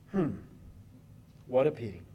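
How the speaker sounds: low, soft deadpan; mock sympathy. Real, not acted. Preacher on Baal's silence, mock sympathy. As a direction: drop it low as dry mock pity.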